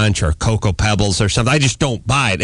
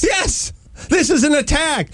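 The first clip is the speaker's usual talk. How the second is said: high-pitched comic outrage; indignant yell. Retold or imitated. Husband ranting about dropped-off pastries, indignant. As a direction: proclaim the grievance, pitch way up. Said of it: "So I'm yelling at my wife".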